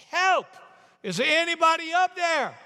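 high, loud cry for help; desperate scream. Acted. Tourist hanging from cliff screaming to heaven, desperate. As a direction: scream up at the sky, stretched out. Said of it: "he's screaming to heaven".

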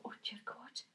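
a breathless whisper of awe; whispered dread. Retold or imitated. Telling the listener about seeing the empty pavilion, whispered. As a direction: whisper it as if afraid to be heard. First sight of big venue.

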